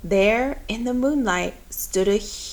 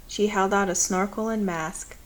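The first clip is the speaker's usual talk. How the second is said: usual speaking voice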